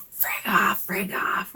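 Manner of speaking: whispered, grumpy brush-off; grandma's grumble. Retold or imitated. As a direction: hiss it away like a cranky grandmother. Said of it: "And she'd say".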